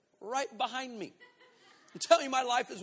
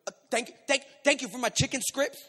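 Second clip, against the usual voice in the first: put-on higher, halting little-kid thanks; imitated kid; 5 semitones higher, 6 dB louder than usual. Kid prompted by mother to thank stranger, halting. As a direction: stumble into it like a shy kid. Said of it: "this kid goes".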